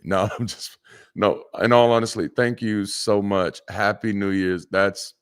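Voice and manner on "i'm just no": ordinary talk, nothing marked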